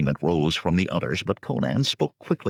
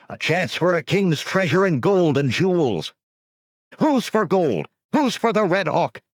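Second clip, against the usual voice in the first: rallying call, high and loud; voice swelling; 14 semitones higher, 5 dB louder than usual. Acted. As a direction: rouse the men with a swelling cry. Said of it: "Conan let his voice swell".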